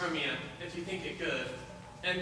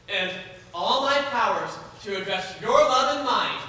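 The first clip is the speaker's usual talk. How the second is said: passionate vow; 4 semitones higher, 8 dB louder than usual. Acted. Lysander vows love for Helena.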